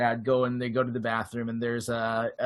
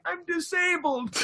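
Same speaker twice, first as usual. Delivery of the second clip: much higher, half-crying yelp; high-pitched wail; 13 semitones higher, 3 dB quieter than usual. Retold or imitated. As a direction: wail it high like a fake sob. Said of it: "He's using that high-pitched voice the whole time".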